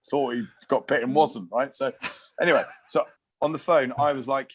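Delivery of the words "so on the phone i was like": normal talking voice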